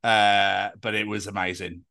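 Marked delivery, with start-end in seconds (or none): none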